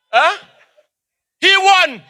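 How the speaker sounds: usual speaking voice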